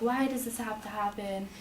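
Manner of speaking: normal talking voice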